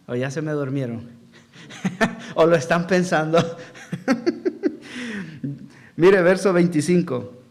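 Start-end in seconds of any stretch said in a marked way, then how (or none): 1.7-2.3 short breathy laugh. Real, not acted. Preacher laughs at sleepy congregation. 3.6-5.6 soft laugh. Real, not acted. Preacher laughs at sleepy congregation.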